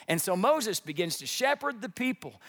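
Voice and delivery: normal talking voice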